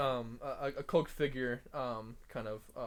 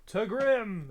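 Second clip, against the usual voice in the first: put-on loud, high drunken cheer; a drunk patron bellows a toast from the back; 10 semitones higher, 12 dB louder than usual. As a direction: shout the toast from the back of the bar. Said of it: "a very drunk person in the back of the bar go".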